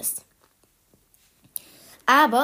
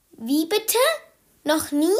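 higher, astonished questions; surprised child; 6 semitones higher than usual. Acted. Anton can't believe his mother never celebrated, surprised. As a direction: gasp it out in disbelief.

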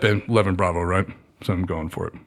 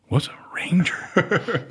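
slow, puzzled whisper, pitched up; baffled whisper; 9 semitones higher, much slower than usual. Retold or imitated. Recruit asking himself about Rangers, slow whisper. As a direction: breathe the question out, lost. Retold own baffled reaction.

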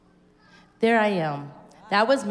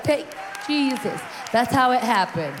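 higher, louder cry of praise; exultant shout; 3 semitones higher, 4 dB louder than usual. Retold or imitated. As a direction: preach the moment in triumph. Reliving speaking in tongues.

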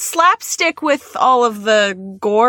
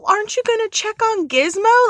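high incredulous; 12 semitones higher, faster than usual. Retold or imitated. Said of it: "I was like".

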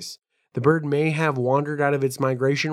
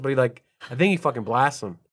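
ordinary talk, nothing marked

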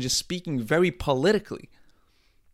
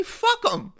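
high squeaky "Fuck 'em" flung out; mock politician outburst. Comic telling Kamala what to say, high mock outburst.

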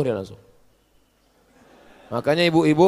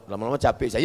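ordinary talk, nothing marked

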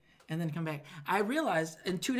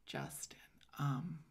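hushed warning; 3 semitones lower, 10 dB quieter, slower than usual. Retold or imitated. Manager scolding him quietly.

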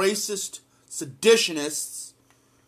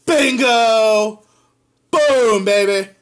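excited cheer; 4 semitones higher, 11 dB louder, much slower than usual. Real, not acted. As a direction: celebrate like a point just scored. Cheering the article's line.

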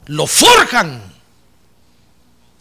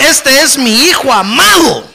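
proud loud proclamation; 5 semitones higher, 6 dB louder, slower than usual. Retold or imitated. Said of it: "sabe qué le dice".

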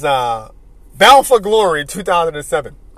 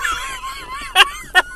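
big laugh; 5 dB quieter than usual. Real, not acted. Laughing at the punch.

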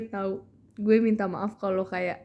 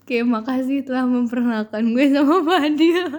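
giggling through an embarrassed thank-you; laughing; 5 semitones higher, 7 dB louder than usual. Real, not acted. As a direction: laugh while saying it.

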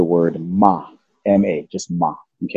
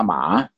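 low dipping "ma"; low valley-shaped "ma"; third tone; 3 semitones lower, 3 dB quieter than usual. Shown as a demonstration. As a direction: dip "ma" down and lift its end. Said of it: "Mom yelled at the horse".

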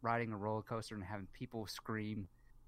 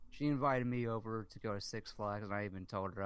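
ordinary delivery

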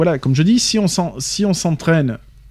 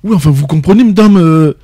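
louder, higher mock youngster; young people's talk; 3 semitones higher, 5 dB louder than usual. Co-host mimics a youngster addressing a recruiter, raised youth talk. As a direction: imitate a youth pleading with madame. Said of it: "beaucoup de jeunes, quand ils parlent".

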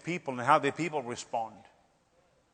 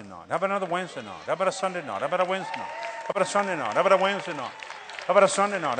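rapid auctioneer chant; auctioneer patter; 3 dB quieter, much faster than usual. Acted. Preacher mocking ministers who bargain over meeting nights, auctioneer patter. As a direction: rattle it off like an auctioneer. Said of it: "I sound like an auctioneer".

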